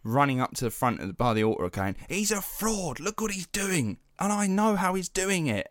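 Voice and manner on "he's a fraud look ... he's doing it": high-pitched mock outrage of an indignant teen; irate teenager. Irate teen denouncing the preacher by the altar, high mock outrage. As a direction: act out an irate seventeen-year-old accusing someone. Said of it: "some seventeen-year-old absolutely irate".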